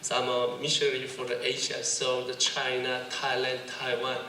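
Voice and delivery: slow, casual American English; laid-back American accent, drawn out. Shown as a demonstration. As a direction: pose as a Korean-American, unhurried. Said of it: "미국 사람 같다".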